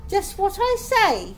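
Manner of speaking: high, loud, firm nanny; nanny laying down the law. A narrator's reading. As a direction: insist like a no-nonsense nanny. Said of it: "still in her nanny voice".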